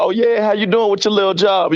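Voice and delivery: fake-polite, patronizing lilt; mock-casual belittling. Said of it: "We be like".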